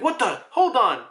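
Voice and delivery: high, loud mock bewilderment; acted startled alarm. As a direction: blurt it high, caught off guard. Acting startled reaction to kick.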